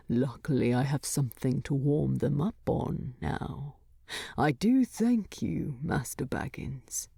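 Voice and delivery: low dwarf voice, sly and dry; Thorin, dry and teasing. Acted.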